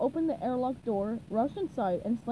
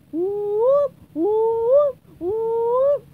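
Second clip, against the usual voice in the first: loud, high siren whoops; vocal alarm whooping; alarm sounds; 10 semitones higher, 12 dB louder, much slower than usual. A narrator's reading. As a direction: wail like a siren, rising each time. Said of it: "started making alarm sounds".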